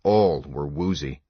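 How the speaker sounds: usual speaking voice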